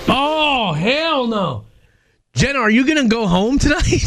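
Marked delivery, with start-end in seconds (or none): 0.1-1.6 spooked refusal. Real, not acted. Reacting to creepy idea.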